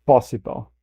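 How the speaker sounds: a light imitation of her Possible; her way of saying possible. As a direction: repeat Possible as she pronounced it, lightly mimicking. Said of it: "the way she says possible".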